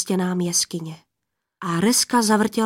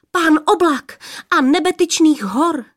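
blurted, breathless; 7 semitones higher, 4 dB louder than usual. A narrator's reading. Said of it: "vyhrkla, až se jí zajík hlas".

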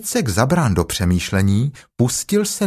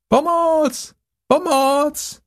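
very high squealed cry for help; thin, high call; much slower than usual. Acted. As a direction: squeal out from up a tree. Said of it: "Volají pomoc".

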